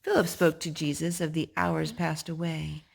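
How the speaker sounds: usual speaking voice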